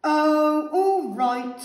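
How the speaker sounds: very loud, exasperated giving in; irritable snap. A narrator's reading. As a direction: snap it like a grumpy villain. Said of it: "snapped the pea".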